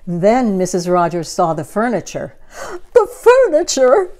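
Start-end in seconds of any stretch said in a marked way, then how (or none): 2.9-4.1 high, loud dismayed cry. Acted. Missus Rogers seeing the dusted furniture, high loud cry. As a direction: shriek out the word in horror. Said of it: "she cried".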